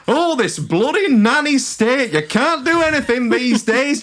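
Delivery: high, indignant Yorkshire moan; Yorkshire accent. Acted. Passenger moaning about security rules, in an angry Yorkshire accent. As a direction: rant as an entitled Yorkshire bloke. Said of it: "I'm giving him a Yorkshire accent".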